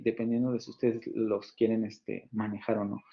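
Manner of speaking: ordinary talk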